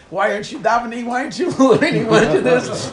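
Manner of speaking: loud nagging rebuke through a laugh; mock scolding. Holy book berates its reader for not praying, loud mock scolding. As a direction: fire off accusing questions, half laughing. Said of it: "He calls you names".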